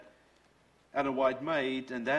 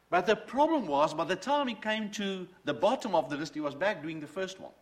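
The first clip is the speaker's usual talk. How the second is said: rising passionate preaching; 5 semitones higher, 5 dB louder than usual. Real, not acted. Preaching builds to full force.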